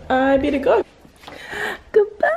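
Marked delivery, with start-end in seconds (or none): 1.9-2.3 high rising goodbye; bright lilting goodbye; singsong goodbye. Pretend. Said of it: "repeated goodbye, sing-song".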